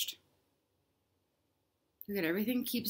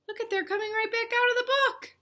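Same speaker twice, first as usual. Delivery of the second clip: much higher, thrilled announcement; delighted; 13 semitones higher than usual. Acted. Reader cheering to children as everyone returns. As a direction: share the joy of the return.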